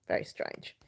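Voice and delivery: breathy whisper. Real, not acted. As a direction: drop to a whisper. Trailing comment, voice dropped.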